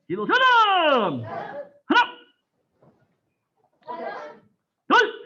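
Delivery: loud Korean count called to the class; shouted count. Real, not acted. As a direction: bark the count so the whole room hears. Instructor calling counts and turns.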